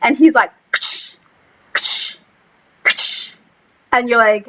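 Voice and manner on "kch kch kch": sharp voiceless "kch" bursts; imitated wand blasts. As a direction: fire off spaced blast noises.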